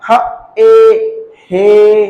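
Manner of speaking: ह and ए apart, then long हे; sounded out slowly. Shown as a demonstration. Teacher spelling by sound for learners, slowly blended. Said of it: "ए pronounce करते हैं".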